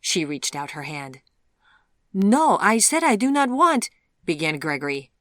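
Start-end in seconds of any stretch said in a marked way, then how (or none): 2.1-3.9 loud, high-pitched indignant refusal; upset protest. Acted. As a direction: protest loudly, patience gone. Man protests as reading is forced.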